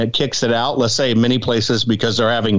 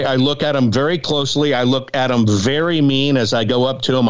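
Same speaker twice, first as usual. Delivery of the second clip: ordinary talk, nothing marked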